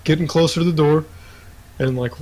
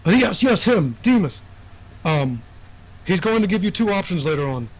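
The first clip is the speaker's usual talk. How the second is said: higher, hurried, anxious warning; nervous urgent npc; 5 semitones higher than usual. Acted. Informant nervously warns the player about option two, higher and hurried. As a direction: rush the warning like a jittery informant. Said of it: "he kinda looks around".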